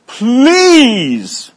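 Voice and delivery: "please" pushed high and loud, pleading; emphatic please. Shown as a demonstration. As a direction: lean hard on "please" as an urgent plea. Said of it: "it's emphatic here".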